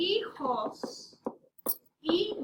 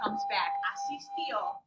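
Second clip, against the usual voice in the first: squeaky, much higher cheer on the word; excited emphasis. Shown as a demonstration. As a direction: jump way up in pitch to celebrate the ending. Teacher excitedly stressing the ending.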